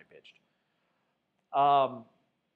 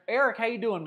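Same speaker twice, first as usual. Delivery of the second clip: higher, louder hello to Eric; excited greeting; 7 semitones higher, 6 dB louder than usual. Real, not acted. Greeting caller Eric by name.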